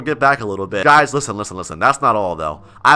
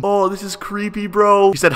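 usual speaking voice

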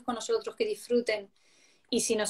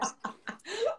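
laugh; 10 semitones higher, 9 dB quieter than usual. Real, not acted. Laughs at own joke.

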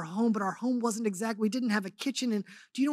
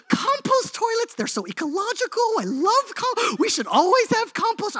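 much higher and louder, bubbly delight; gushing enthusiasm; 9 dB louder than usual. Retold or imitated. Wife Tehillah gushing about compost toilets, high excited delight. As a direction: gush like it's the adventure of a lifetime. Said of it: "She's like".

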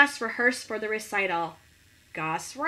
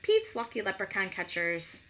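ordinary delivery, nothing marked

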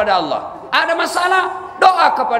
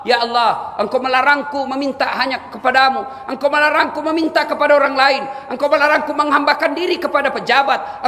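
raised, fervent pleading prayer; earnest prayer; 5 semitones higher than usual. Shown as a demonstration. Preacher showing the congregation how to pray, fervently. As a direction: pour out the plea to God.

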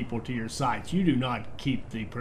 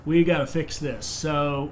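ordinary delivery

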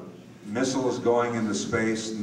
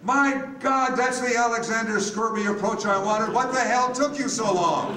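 much higher, louder, laughing with glee; delighted outburst; 14 semitones higher, 4 dB louder than usual. Retold or imitated. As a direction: burst out in joyful surprise, half laughing. Director delighted by the robot take.

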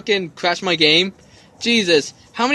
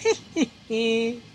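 low, softer taunting villain voice; mocking villain; 3 semitones lower, 5 dB quieter, slower than usual. Player voicing the villain scoffing at the hero, mocking. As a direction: sneer it slowly with a little giggle.

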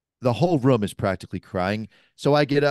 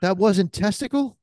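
shocked disbelief; 7 semitones higher than usual. Retold or imitated. Shocked realization retold.

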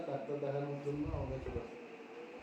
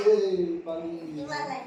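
loud call, pitch jumping higher; yelling across the room; 4 semitones higher, 7 dB louder, slower than usual. Real, not acted. Calling out about the child.

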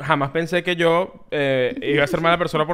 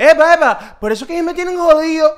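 higher, louder aggrieved complaint; mock whiny nice guy; 11 semitones higher, 5 dB louder than usual. Mocking a guy who claims he's too nice, aggrieved whine. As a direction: pitch it up like a put-upon guy. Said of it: "la que estás imitando".

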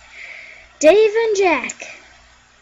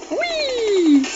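high gleeful squeal; excited whee; 7 semitones higher, 4 dB louder than usual. Real, not acted. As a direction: squeal like on a ride. Diving in a game.

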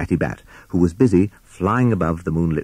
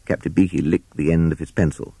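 ordinary delivery, nothing marked

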